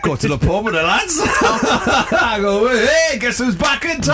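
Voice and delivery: high, eager and laughing; overexcited babbling. Real, not acted. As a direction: gush about going out with the lads. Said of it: "You sound a bit overexcited".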